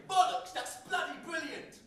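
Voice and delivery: "Bollocks, that's bloody brilliant!" shouted high and loud in British; shouted British swearing. Retold or imitated. Poet shouting British slang for grinning American fans, loud and high. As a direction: belt out "Bollocks" like a proud Brit showing off. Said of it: "I'd say".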